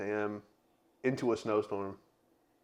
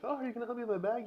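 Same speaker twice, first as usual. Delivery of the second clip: high entitled voice; 12 semitones higher, 5 dB quieter, much faster than usual. Retold or imitated. Quoting entitled woman.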